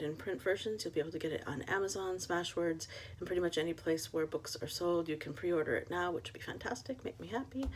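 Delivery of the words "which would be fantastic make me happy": whispered aside, a little higher; conspiratorial whispered plug; playful whisper. Real, not acted. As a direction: slip it in as a hushed hint. Playful aside begging pre-orders.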